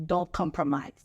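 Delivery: ordinary delivery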